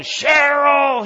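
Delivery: loud, high drawn-out yell of a name; angry scream. Retold or imitated. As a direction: bellow for your wife across a crowded terminal. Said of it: "he keeps yelling".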